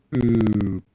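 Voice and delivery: long drawn-out boop; non-instant boop. Shown as a demonstration. Host showing light takes time to travel, drawn-out boop. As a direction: stretch the boop out, letting it sink. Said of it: "It's not instantaneous".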